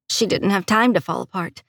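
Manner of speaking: ordinary delivery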